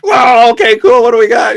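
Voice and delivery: loud, high mock-excited reaction; a burst of hammed-up excitement; excited shout. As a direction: shout it like you can't wait to hear it. Band reacting excitedly to new file.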